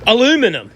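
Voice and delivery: "aluminum" in American style, four syllables, stress on LU. Shown as a demonstration. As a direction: say "aluminum" the American way, loud and proud. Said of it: "Or as we say in the greatest civilization".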